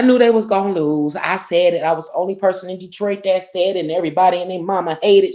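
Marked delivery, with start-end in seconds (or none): none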